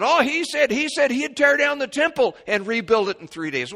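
high, loud sneering gossip; a scoffing witness spreading the rumour; mocking false witness. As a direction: twist his words with a sneer. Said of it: "false witnesses went away from there and said".

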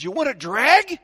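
high, loud put-on offer; tempting stranger. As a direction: call out a cocky offer from the next car. Acting the driver offering a smoke.

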